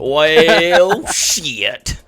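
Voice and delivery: cracking up at the bad news; laughing curse. Real, not acted. Laughing at the map reveal.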